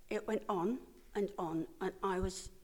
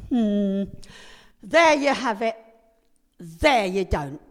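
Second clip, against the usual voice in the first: imitating a doctor musing aloud, louder and slow; puzzled doctor; 14 dB louder, slower than usual. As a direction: voice the baffled doctor, drawn out and loud. Doctor puzzling over x-rays.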